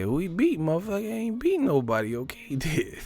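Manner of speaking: high-pitched dismissive impression, cracking up at the end; Roger Mayweather. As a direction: imitate a blunt trainer, laughing on the last words. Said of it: "Roger Mayweather voice".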